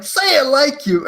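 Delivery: high, urgent mock demand; hammed-up straining plea; straining movie hero. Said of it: "And he's like".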